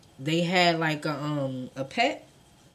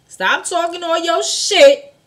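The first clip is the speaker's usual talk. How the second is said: angry yell; 11 semitones higher, 11 dB louder, slower than usual. Retold or imitated. Barry scolding drunk.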